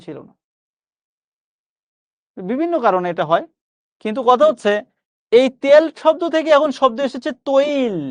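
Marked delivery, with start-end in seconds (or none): 5.3-8.0 slow deliberate explanation ending on তৈল; slow teacher voice; careful demo. Shown as a demonstration. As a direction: stress তৈল with a rounded diphthong. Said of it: "actual উচ্চারণ হচ্ছে তৈল".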